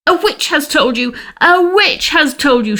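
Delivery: enraged dwarf screeching at being found out; shrieking little man. A narrator's reading. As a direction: scream it in raging disbelief. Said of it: "shrieked the little man".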